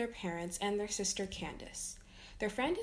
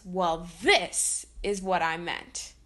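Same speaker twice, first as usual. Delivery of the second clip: villain reveal; 4 semitones lower, 4 dB louder, slower than usual. Acted. Doofenshmirtz revealing plan.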